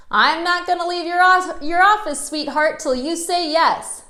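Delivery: imitating a stubborn old salesman who won't leave; pushy old salesman. Old ad man refuses leaving.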